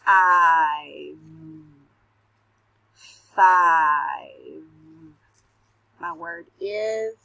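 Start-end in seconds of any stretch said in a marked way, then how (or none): 0.0-4.6 Five stretched out sound by sound; sounding out words. Shown as a demonstration. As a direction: sound out each piece. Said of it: "Say them with me".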